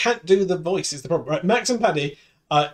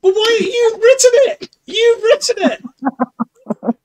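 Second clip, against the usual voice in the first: much higher, louder incredulous protest; exasperated outrage; 6 dB louder than usual. Real, not acted. Host protests to the AI that refused its own script, exasperated. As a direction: argue with the machine in disbelief.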